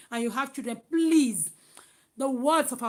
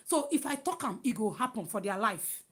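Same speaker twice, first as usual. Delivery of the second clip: ordinary talk, nothing marked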